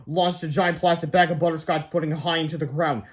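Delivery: plain reading voice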